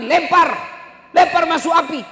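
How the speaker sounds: high, loud acted order; shouted command. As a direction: call out the order forcefully. Nabi memberi perintah melempar. (perintah dengan teriakan lantang)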